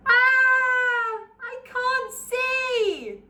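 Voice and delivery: high, loud, slow blinded scream; ogre's scream. Acted. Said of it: "The ogre screamed".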